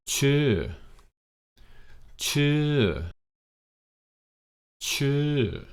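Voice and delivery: "Chư" starts high and falls sharply; high falling tone on "Chư". Shown as a demonstration. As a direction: bring "Chư" up then drop it down. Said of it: "get that falling tone in your voice".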